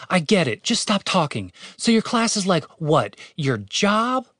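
Catherine, fed up. Acted. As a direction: cut him short, then ask pointedly.

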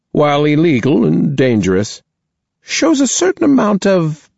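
a headmistress weighing her words; stern principal voice. Acted. Principal praises student.

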